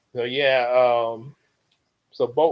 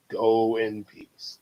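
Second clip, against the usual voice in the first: slow blessing; 4 semitones lower, 4 dB quieter, much slower than usual. Retold or imitated. Church announcement parody.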